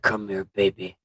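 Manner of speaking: low slurred robot come-on; deep flat robot flirting; drunken robot voice. Pretend.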